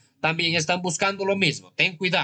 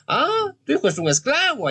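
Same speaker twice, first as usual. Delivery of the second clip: startled revelation; 11 semitones higher, 3 dB louder than usual. Real, not acted. Healer reveals son's enslavement.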